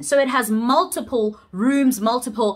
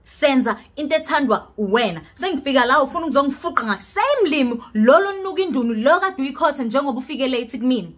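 normal talking voice

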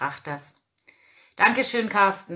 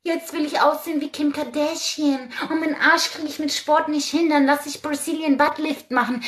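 higher, louder sneering vain voice; mocking vain woman; 6 semitones higher, 4 dB louder than usual. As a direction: parody a shallow beauty fan. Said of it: "wenn ich sage".

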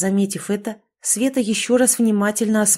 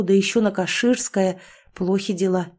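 ordinary delivery, nothing marked

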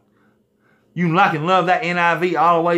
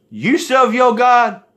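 mocking taunt; 7 semitones higher, 4 dB louder, slower than usual. Mocking taunt to NIV readers.

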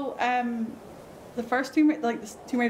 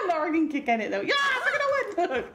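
giggling while talking, pitched higher; laughing through words; 9 semitones higher, faster than usual. Real, not acted. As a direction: bubble over with giggles mid-sentence. Giggling at Lara's kicking.

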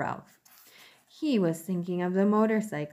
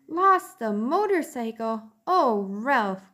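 higher, louder alarmed mother; 5 semitones higher, 6 dB louder, slower than usual. Acted. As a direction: cry out in alarm. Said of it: "cried his mother, genuinely alarmed".

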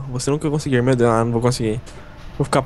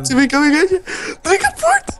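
panicked shout. Real, not acted. Panic as monster appears.

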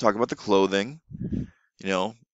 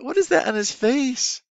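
put-on teacher voice for kids; 3 dB louder than usual. Shown as a demonstration. Online teacher asking a young student, sing-song and high. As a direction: ask it like a playful teacher to a toddler. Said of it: "I say".

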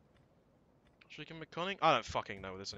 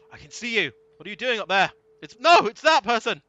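loud, high excited yell at the screen; loud, high real excitement; 10 semitones higher, 19 dB louder than usual. Real, not acted. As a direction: shout out the discovery, thrilled. Spots hidden enemy army, yelling.

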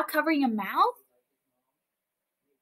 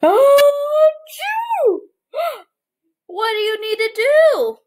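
high gasping oh, louder; mock surprise; 5 dB louder than usual. Teacher asks children what to do, hammed-up surprised gasp. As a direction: gasp big, like kids' show surprise.

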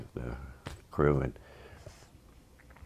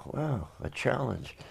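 wry; 9 semitones higher, 10 dB quieter, slower than usual. Retold or imitated. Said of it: "I go".

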